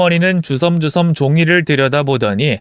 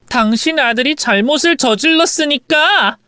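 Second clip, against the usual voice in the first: higher, mildly raised retort; yelling back; 11 semitones higher than usual. Acted. Wife answering mother-in-law back, mildly yelling. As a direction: throw it back at her, pitched up. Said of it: "저도 같이 소리를 질렀더니".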